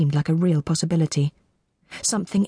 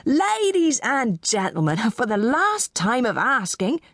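slow, higher-pitched auctioneer; auctioneer's last call; 7 semitones higher than usual. Acted. Auctioneer to the room, slow last call. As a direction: draw out the final appeal.

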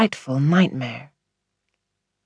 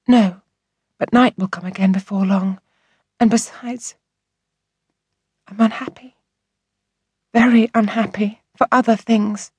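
put-on higher childlike voice, unhappy; upset young girl; 6 semitones higher than usual. A narrator's reading. Orphaned girl telling the doctor her troubles, sad child voice. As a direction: speak as a sad little girl.

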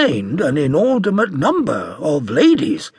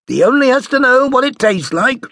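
mother's voice; 12 semitones higher, 7 dB louder than usual. A narrator's reading. Mother answers father.

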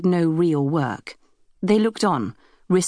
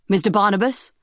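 raised call across the deck; 4 semitones higher than usual. Acted. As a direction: call his name across the ship. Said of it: "called the captain".

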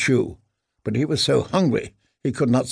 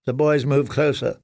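ordinary delivery, nothing marked

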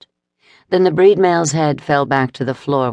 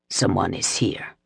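vampire growl; 7 semitones lower, 6 dB quieter than usual. Acted. Vampire senses intruder, low growl.

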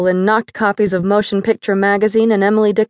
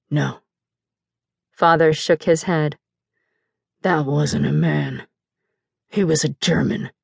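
cold denial; 4 semitones lower, 3 dB quieter than usual. Acted. Drunk father denying to his wife, low cold slurred voice. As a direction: say it flat and low like it's nothing.

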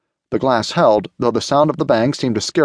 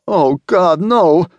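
usual speaking voice

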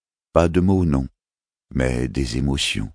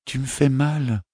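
on the verge of tears; 11 semitones higher than usual. Acted. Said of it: "murmura-t-il au bord des larmes".

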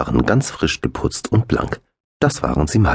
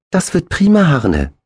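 much higher and louder, bursting with joy; delighted; 13 semitones higher, 4 dB louder than usual. A narrator's reading. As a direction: cheer it like a thrilled little boy. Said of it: "sagte er begeistert". (begeistert, viel höher und lauter)

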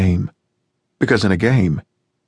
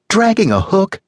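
gushing neighbour on the phone, much higher; Janice, cheerful American; 11 semitones higher than usual. A narrator's reading. Janice voiced in read story.

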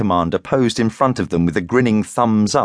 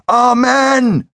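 high, loud drawn-out roar; 6 dB louder than usual. A narrator's reading. Cult dancers answering their mystic in the graveyard, roaring. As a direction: roar it like a whole crowd answering. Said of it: "they roared".

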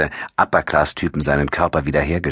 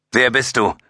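higher, mildly raised question; calling out; 8 semitones higher than usual. A narrator's reading. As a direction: call out the challenge in a raised pitch. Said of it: "rief Nash".

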